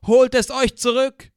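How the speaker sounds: defiant rallying scream; loud defiant cry. Acted. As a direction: hurl it like a rebel yell off a cliff. Rebel cry repeated over the PA.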